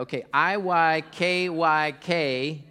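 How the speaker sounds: I Y K Y K, each letter slow and loud; spelled out slowly. Shown as a demonstration. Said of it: "nobody got that".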